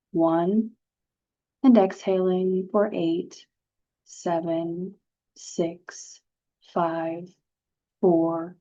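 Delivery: slow, measured breathing-exercise delivery with long pauses; guided meditation. Acted. Instructor settling participants into slow breathing, guided meditation. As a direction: lead them unhurriedly through each breath, letting every count hang.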